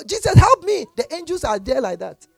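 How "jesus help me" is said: high, loud mock plea; mock desperate plea; pleading sinner. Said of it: "It means you go".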